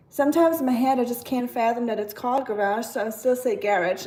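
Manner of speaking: usual speaking voice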